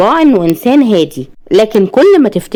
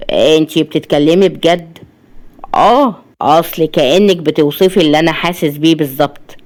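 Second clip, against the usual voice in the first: low, restrained man's voice; low and earnest male character; subdued male patient; 4 semitones lower than usual. Acted. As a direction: drop the pitch for a sincere, moved patient.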